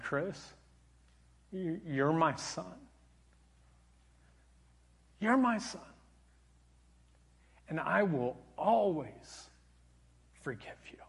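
hushed, loving retold pardon; tender forgiveness. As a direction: let each phrase land gently, with long pauses. Mother tenderly forgives son, retold.

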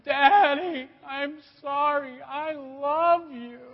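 put-on high, slow, teary child voice; a daughter crying to her dad; crying little girl. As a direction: whimper it like a sorry little girl. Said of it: "she's crying".

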